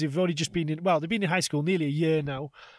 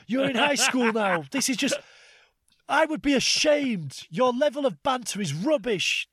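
teacher losing it; 8 semitones higher than usual. Retold or imitated. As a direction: tear into the class like a fed-up teacher. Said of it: "I just completely lost it".

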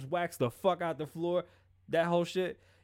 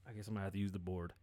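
sighing mutter; 7 semitones lower, 9 dB quieter, much faster than usual. Real, not acted. Sighing aside about soundboard.

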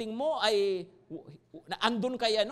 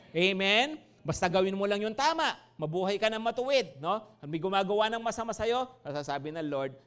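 normal talking voice

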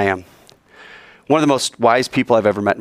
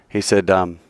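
ordinary delivery

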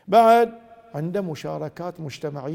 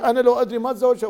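fast, louder mock complaint; regretful outburst; 5 semitones higher, 6 dB louder, much faster than usual. Husband complaining about his wife, fast mock outburst. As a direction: blurt out the regret quickly. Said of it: "هي ما قالت إياها كذي".

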